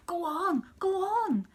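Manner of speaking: put-on high, loud eager child pleading; excited little girl urging go on; eager begging. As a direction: beg her to keep reading. Child begging aunt to continue.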